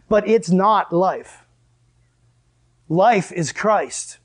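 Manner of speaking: very slow, each word weighed; slow emphasis. Real, not acted. Preacher to congregation, slowing on the key truth. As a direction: lay it down slowly and firmly.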